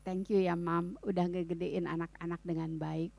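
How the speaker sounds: low, soft retold gratitude; imitating a grateful husband, softly; tender thanks. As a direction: thank your wife gently and quietly.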